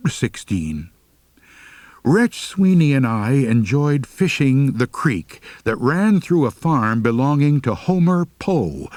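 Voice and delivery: ordinary delivery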